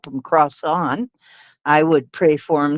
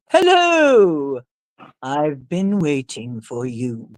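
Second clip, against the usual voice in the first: slow, precise, eerie radio greeting; spooky radio preacher; 2 semitones lower, slower than usual. Retold or imitated. Preacher greeting her radio listeners, slow and spooky. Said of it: "very precise diction and so on".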